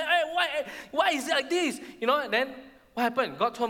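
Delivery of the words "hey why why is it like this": high-pitched, indignant complaint; mock a crowd telling someone off; scolding. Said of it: "They scolded".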